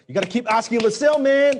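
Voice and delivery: high, loud, fast hard-sell voice; mock the hustle-bro who never stops asking; hammed-up sales coach pushing the close; pushy salesperson. Mocking pushy sales advice.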